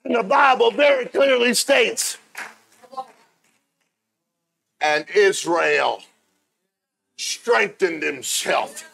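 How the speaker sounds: high, loud, drawn-out pulpit shout; full-force preaching. Real, not acted. Preacher urges congregation to become who they should be, full-force preaching. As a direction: preach it up high, hammering each phrase.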